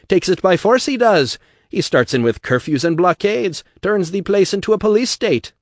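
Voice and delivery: loud, high Irish rabble-rouser; Irish brogue bellow. Acted. Atlas harangues the crowd in a loud Irish brogue. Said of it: "his voice carrying over all Apollo Square".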